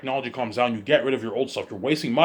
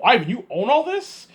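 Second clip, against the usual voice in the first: mock shocked Peter; 9 semitones higher than usual. Peter Jackson shocked by land.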